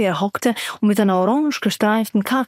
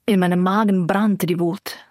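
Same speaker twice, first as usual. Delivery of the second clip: ordinary delivery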